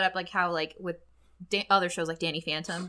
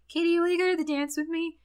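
higher, put-on smooth asker; mock sweet invite; 8 semitones higher than usual. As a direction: ask her out in a put-on voice. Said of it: "he's just like".

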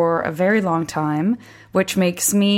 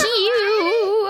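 normal talking voice

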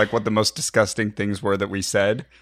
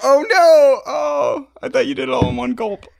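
loud, much higher horrified oh no; shocked dismay; 6 dB louder than usual. Real, not acted. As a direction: gasp it out in disbelief. Dismay that more pee remains.

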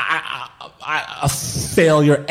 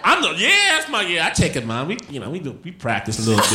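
ordinary talk

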